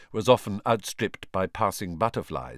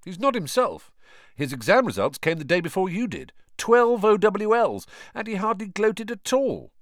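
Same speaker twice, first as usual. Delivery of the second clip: put-on higher lively teen boy voice; Fred's voice; 11 semitones higher, 4 dB louder than usual. A narrator's reading. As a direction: voice him bright and higher, teasing. Fred voiced, young and lively.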